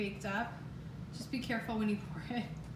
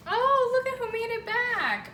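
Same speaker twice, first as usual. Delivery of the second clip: excited greeting; 11 semitones higher, 9 dB louder than usual. Real, not acted. Mom greets child arriving.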